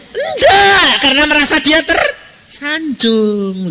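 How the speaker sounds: high, laughing shout. Real, not acted. Preacher laughing to the congregation at the punchline. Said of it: "Loh, lucu tak?".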